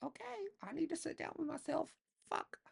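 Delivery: high, airy mock self-talk; high exasperated self-talk; inner voice. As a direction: squeak it out to yourself in a high put-on voice. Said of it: "I'm sitting here like".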